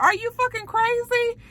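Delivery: the same shocked shriek, now his own thought; incredulous high squeal. Retold or imitated. The recapper echoes the line to himself in a high incredulous squeal.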